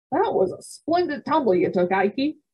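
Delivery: high, eager praise for his brother; admiring. A narrator's reading. Bright admiring voice. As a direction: Frank praising Ike's fall, admiring. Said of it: "said my brother Frank admiringly".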